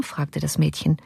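plain reading voice

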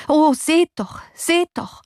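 high, strained cry of horror; officer crying out in horror at the mob; horrified cry. A narrator's reading. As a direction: cry out at the sight of the murder.

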